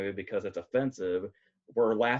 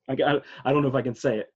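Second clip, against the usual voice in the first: normal talking voice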